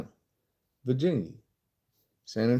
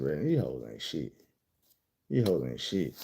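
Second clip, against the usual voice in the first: much lower and softer, to himself; low aside; 9 semitones lower, 8 dB quieter than usual. Retold or imitated. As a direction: say it under your breath, pitch dropped. Muttered verdict aside, heard in measures.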